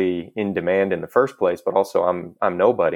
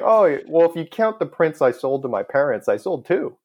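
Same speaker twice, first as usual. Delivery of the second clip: higher-pitched, self-mocking little brag; mock boast; 8 semitones higher than usual. Photographer jokes about prints sold to parents, mock boasting. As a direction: make a feeble boast sound proud, as a joke.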